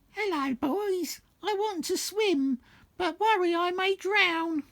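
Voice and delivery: very high, slow put-on lady voice; squeaky high falsetto old bag; old woman voice. The old woman asks the boys for help, in a high falsetto. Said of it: "She said".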